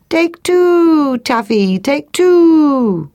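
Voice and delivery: high, long cooing call, falling away; silly turtle dove cry. Acted. Turtle dove ignoring the lesson, silly high cooing cry. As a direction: coo it like a dove, stretching each "two". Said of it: "making its silly cry".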